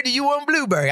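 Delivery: high-pitched mock waitress question; imitated waitress. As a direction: play the waitress offering a choice, pitched well up. Acting the waitress offering choices.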